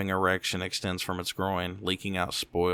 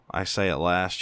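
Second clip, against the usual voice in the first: usual speaking voice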